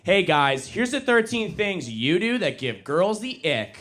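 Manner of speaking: put-on high, bright valley-girl influencer voice; hammed-up influencer voice, high and loud; mocking influencer girl. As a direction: parody a perky TikTok girl listing turn-offs. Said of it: "beautiful women ... saying things like".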